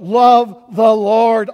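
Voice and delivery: loud, slow cry of "Love the Lord". Acted. Preacher crying out David's call to the saints, loud and slow. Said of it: "he cries out".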